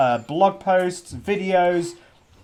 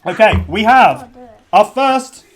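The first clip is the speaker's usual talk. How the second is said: excited announcement; 4 semitones higher, 9 dB louder, slower than usual. Real, not acted. Announcing the big news.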